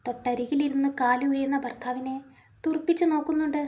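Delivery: plain reading voice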